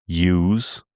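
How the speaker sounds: yous said "yooz" with a rise; yous rising like a Dubliner. Shown as a demonstration. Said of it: "they'll tend to use".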